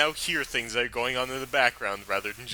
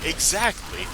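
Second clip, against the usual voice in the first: excited agreement; 8 semitones higher, 4 dB louder, slower than usual. Real, not acted.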